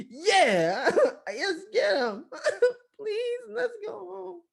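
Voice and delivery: high, squeaky mock bravado, half laughing; fake eagerness. Storyteller feigns joining the chase, fake eagerness. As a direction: cheer along, then slip into a tiny plea.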